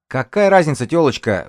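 high, loud yelp; squealed. A narrator's reading. Young lookout snaps back at the woman, high squeal. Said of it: "взвизгнул глашатай".

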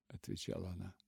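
ordinary delivery, nothing marked

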